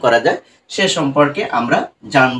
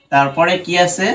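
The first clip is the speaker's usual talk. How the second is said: ordinary delivery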